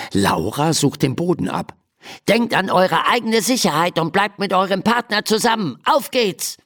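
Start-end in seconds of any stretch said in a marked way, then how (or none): 2.3-6.6 high-voiced little fire chief; mouse man calling. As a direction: call out orders as a small mouse. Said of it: "ruft ein kleiner Mäusemann". (hoch rufender Mäusemann)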